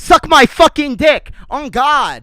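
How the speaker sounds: loud high rant; furious shout. Real, not acted. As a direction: scream it back at the haters. Yelling back at imagined commenters.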